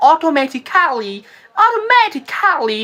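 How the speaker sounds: অটোমেটিক্যালি drawn out with a showy "KAA-li"; stylised, slow "o-to-me-ti-kaa-li" for অটোমেটিক্যালি; affected stylish mispronunciation. Retold or imitated. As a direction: put on style, stretching the end of অটোমেটিক্যালি. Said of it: "অনেকে style করে বলেন".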